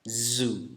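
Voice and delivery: "Zu" with a long buzzing z; voiced Z demo. Shown as a demonstration. Teacher showing vocal cord vibration, voiced Z. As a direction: hold the buzz at the start of "Zu", softly. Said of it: "bunyi yang bergetar pita suara kita".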